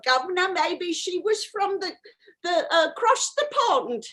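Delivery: a light put-on British accent with a catch in the voice; playing a proper English lady from across the pond; mock British accent. Comic medium slips into a light British accent. Maybe she was a Southern Belle.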